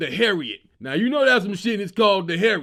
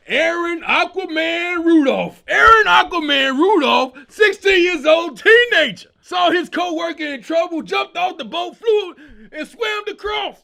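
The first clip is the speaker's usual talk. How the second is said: hype announcer shout; 7 semitones higher, 9 dB louder than usual. Acted. Hyping the teen swimmer hero.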